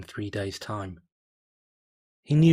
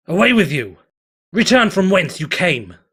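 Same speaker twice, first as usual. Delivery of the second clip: loud banishing command, pitch raised; commanding shout; 6 semitones higher, 11 dB louder than usual. Acted. Stallion banishing the fire spirit, loud command. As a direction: drive the spirit out with a booming order.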